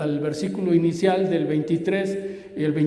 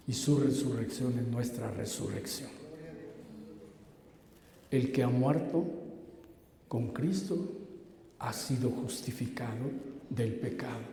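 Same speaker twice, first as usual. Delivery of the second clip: low, soft, spaced-out solemn delivery; hushed preaching; 6 semitones lower, 10 dB quieter than usual. Real, not acted. Preacher explaining death and resurrection with Christ, hushed and low. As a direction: let each phrase settle softly, with long pauses.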